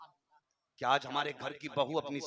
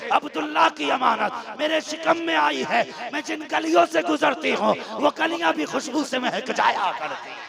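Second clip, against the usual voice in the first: building from raised voice to high, loud declamation; impassioned tearful confession, retold; 8 semitones higher, 6 dB louder than usual. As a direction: preach her confession with mounting force. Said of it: "रो के कहती".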